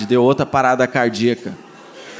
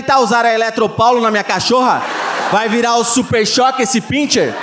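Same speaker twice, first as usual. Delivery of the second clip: mock outraged yell; 6 semitones higher than usual. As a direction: yell in disbelief at the price. Shouting at vet over the bill. (grito indignado de brincadeira, rindo)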